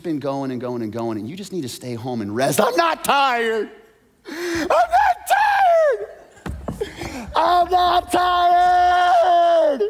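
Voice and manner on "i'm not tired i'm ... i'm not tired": much higher, louder, slow drawn-out shouted whine; a worn-out kid shouting he's not tired; stubborn teen protest. Retold or imitated. Parent's teen refusing rest, shouted high drawn-out whine. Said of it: "that's your eighteen-year-old".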